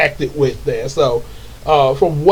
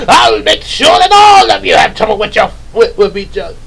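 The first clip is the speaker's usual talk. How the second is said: hammed-up villain vow, loud and high; shouted evil scheming; Rita and Lord Zed; 5 semitones higher, 6 dB louder than usual. Villain vowing trouble for the heroes, menacing shout. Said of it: "like the way Rita and Lord Zed".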